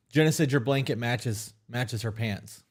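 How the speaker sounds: ordinary talk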